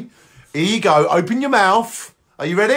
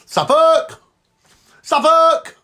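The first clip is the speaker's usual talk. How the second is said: high loud yell; shouting Suffolk; 10 semitones higher, 3 dB louder, much slower than usual. Acted. Host yelling Suffolk after slaving over the cooker, loud. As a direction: bellow the county name. Yells hushed between.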